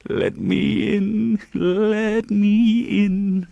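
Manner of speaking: high, very slow sobbing plea of a ghost; sobbing ghost child. A narrator's reading. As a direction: wail it slowly like a lost child spirit. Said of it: "a most melancholy voice sobbed".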